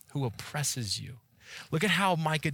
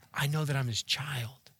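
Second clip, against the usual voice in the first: ordinary delivery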